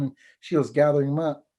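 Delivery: usual speaking voice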